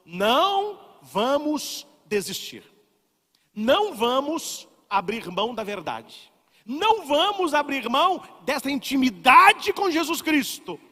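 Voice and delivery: rally them not to give up; fervent exhortation. Acted. Priest urging the faithful, building fervent preaching.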